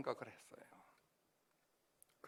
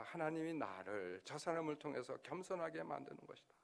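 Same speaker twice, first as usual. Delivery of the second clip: normal talking voice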